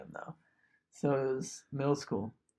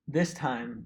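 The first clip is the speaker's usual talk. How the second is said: normal talking voice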